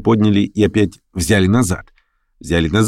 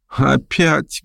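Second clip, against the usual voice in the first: higher-pitched protest, only lightly cried out; mild cry; 7 semitones higher than usual. A narrator's reading. Guest asked to play piano, mildly crying out. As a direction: cry out in protest without real volume. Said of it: "крикнул Лямшин".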